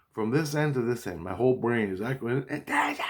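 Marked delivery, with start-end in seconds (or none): 2.5-3.1 high alarm-like yelp on one word; imitated alarm cry. Brain echoing with the alarm, high siren shriek. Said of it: "Like an alarm".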